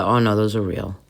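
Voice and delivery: ordinary delivery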